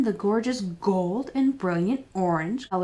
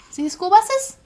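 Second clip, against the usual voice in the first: excited question; 12 semitones higher, 6 dB louder than usual. Acted. Reading to children, asking.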